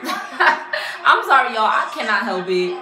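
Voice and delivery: loud apology through real laughter; laughing. Real, not acted. Reactor apologizing to viewers for cracking up, laughing. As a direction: laugh helplessly through the words.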